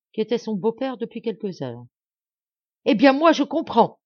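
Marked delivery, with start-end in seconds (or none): 2.9-4.0 loud, much higher outburst; an enraged son crying out; furious exclamation. A narrator's reading. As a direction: explode with it. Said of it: "s'écria-t-il".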